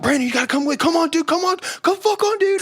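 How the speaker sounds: high, frantic mock pleading; urgent pleading. Friend begging Brandon to come outside after the band, urgent high pleading. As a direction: imitate a friend urging you along, voice climbing very high.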